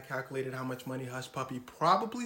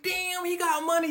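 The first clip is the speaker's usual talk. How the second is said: impressed onlookers; 12 semitones higher, 6 dB louder than usual. Retold or imitated. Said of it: "People were like".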